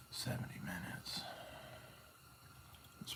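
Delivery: whispered to himself. Real, not acted. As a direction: breathe the words without voice. Reading a timer to himself.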